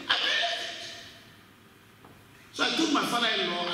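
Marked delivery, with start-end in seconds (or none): 0.1-0.7 higher, building on the repeat; high excited affirmation. Real, not acted. Preacher echoing himself with rising fervour. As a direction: push it up with conviction.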